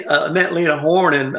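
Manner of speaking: usual speaking voice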